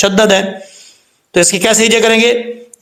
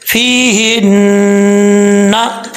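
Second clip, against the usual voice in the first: slow recitation; much slower than usual. Shown as a demonstration. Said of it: "teacher reads the verse word".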